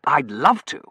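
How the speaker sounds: loud, lifted, eager acceptance; emphatic, ardent reply; fervent. A narrator's reading. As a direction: accept with real fervour. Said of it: "Terence said fervently".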